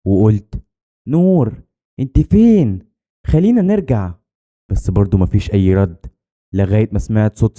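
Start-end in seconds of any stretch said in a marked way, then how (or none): 1.1-4.1 higher, worried call for a friend; frightened calling. A narrator's reading. Scared girl seeks her friend among the graves, frightened calling. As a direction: call out as a scared child searching. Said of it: "وأنا خايفة".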